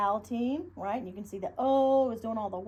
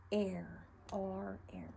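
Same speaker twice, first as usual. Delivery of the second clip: whispered pirate sounds; 9 dB quieter, much slower than usual. Shown as a demonstration. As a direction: breathe it like a sneaky pirate. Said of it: "get your pirate hook".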